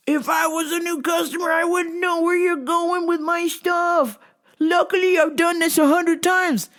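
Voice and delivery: ranting customer, high and strained; yelling customer. Retold or imitated.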